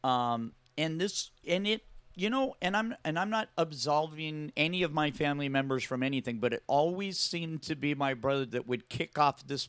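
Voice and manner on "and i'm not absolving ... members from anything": ordinary delivery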